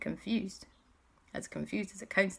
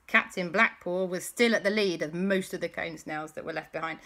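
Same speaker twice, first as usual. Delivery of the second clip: ordinary delivery, nothing marked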